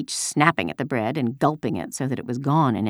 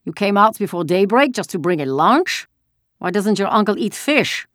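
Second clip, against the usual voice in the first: higher, pointed soldier's questioning; 7 semitones higher, 5 dB louder than usual. A narrator's reading. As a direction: question the girl as the soldier, voice lifted. Soldier questioning the girl in story.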